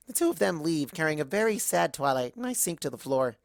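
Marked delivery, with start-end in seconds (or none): none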